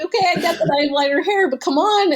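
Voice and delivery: high, fast mock exasperation at being mistaken; exasperated. Telling a friend about runners mistaking her for Russian, exasperated.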